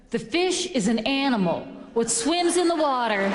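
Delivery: acted-out waitress spelling out the obvious; slow and careful. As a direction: explain it carefully as if to someone dim. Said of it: "kinda slow and careful so as I can understand her".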